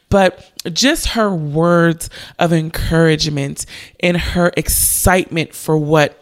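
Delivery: usual speaking voice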